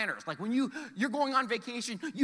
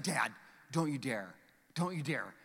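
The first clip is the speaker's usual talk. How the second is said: put-on low, hushed warning from a mortified kid; understated mock dread; imitated begging; 7 semitones lower, 6 dB quieter than usual. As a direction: plead quietly with Dad, lightly acted. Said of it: "he was begging me not to do it".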